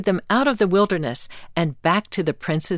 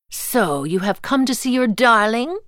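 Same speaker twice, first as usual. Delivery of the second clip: higher mocking witch taunt; cackling witch; 5 semitones higher, 3 dB louder than usual. A narrator's reading. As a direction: taunt him like a gloating witch. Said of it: "cackled the witch".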